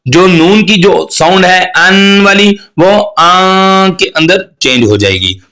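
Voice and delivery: normal talking voice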